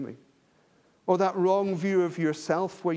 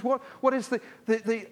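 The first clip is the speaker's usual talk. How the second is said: ordinary talk, nothing marked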